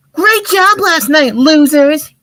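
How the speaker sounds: called taunt. Acted. Kid on a bike taunting the gang, high mocking call. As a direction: jeer at them like a bratty kid riding past. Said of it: "he called over his shoulder".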